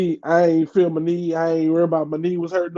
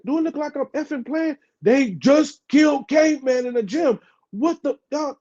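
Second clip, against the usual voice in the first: high, frantic retold outburst; cussing out; 9 semitones higher than usual. He tells the office lady about the shooting, high frantic anger. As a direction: tear into the office lady in panic. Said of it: "I cussed out Miss Cobb".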